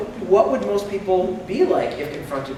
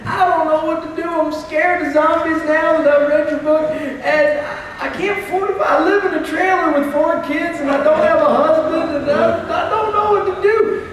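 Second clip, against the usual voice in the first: high, anxious, pleading woman's voice; high and frantic, near tears; panicked caller; 11 semitones higher, 5 dB louder than usual. Retold or imitated. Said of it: "she's like".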